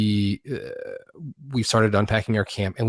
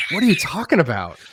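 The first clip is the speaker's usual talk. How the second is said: higher-pitched baffled question; incredulous, raised-pitch retelling; retold bafflement; 7 semitones higher, 4 dB louder than usual. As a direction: act out being thrown by an accusation. Baffled reply to accusation, retold.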